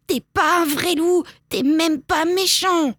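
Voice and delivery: put-on high-pitched child's bawl. A narrator's reading. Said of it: "jette le livre et braille".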